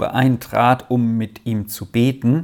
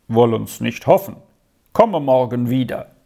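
low-key, matter-of-fact reply; brisk doctor; 3 semitones lower than usual. A narrator's reading. Doctor leaving an anxious aunt, brisk and reserved. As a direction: answer briefly, giving nothing away. Doctor's brief answer, later echoed despairingly.

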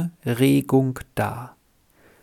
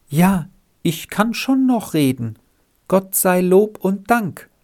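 put-on bright child voice, higher; a small girl answering cheerfully; child chirping thanks, much higher; fresh child voice; 7 semitones higher than usual. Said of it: "mit ganz frischer Stimme".

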